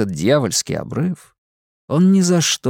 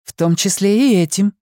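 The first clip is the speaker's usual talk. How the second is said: higher, sharp indignant reply; sharp retort; 9 semitones higher than usual. Acted. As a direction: snap back with indignation. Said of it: "резко отозвалась Симона".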